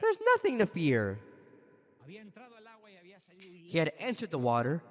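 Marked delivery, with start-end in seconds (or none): 0.0-1.2 high-pitched "See", then falling to reassurance; triumphant. Retold or imitated. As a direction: imitate a victor proving his point. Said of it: "lifts his hand in triumph".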